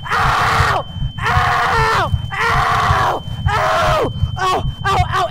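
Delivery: high, loud "ow" howls in a row; hammed-up pained yelping; screams of pain. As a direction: howl in agony over and over. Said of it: "it gives me great pain".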